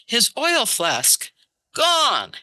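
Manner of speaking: slow "His oil flask", then "gone" jumps high; slow, then pop "gone" up high; punchline delivery. Shown as a demonstration. Reciting his translation as a joke, slow with a high "gone". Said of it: "the way I translated it".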